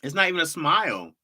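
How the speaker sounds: usual speaking voice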